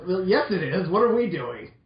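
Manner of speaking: ordinary talk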